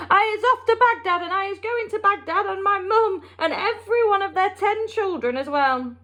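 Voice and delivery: hammed-up boast while galloping; mock giant shout. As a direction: Flesh Lump Eater yelling to the BFG. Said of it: "is waving his arms and shouting at me".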